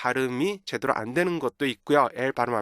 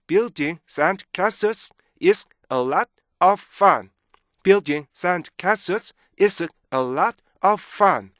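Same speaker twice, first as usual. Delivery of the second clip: very slow, higher, word-by-word reading; broken into chunks; 4 semitones higher, 3 dB louder, much slower than usual. Shown as a demonstration. Teacher showing the original sentence, slow and separated. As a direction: chop the sentence with clear stops. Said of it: "원래 이 문장은요".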